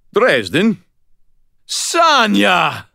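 high, loud call; howled. A narrator's reading. Injured man calling to his arriving friend, howled. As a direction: howl it across the yard. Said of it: "I howled".

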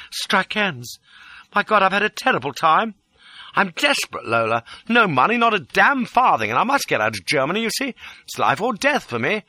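high-pitched, urgent outpouring; distraught pleading. A narrator's reading. Fugitive begging Lola for help, distraught. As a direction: play desperate to win her over. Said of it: "The distraught pleading line".

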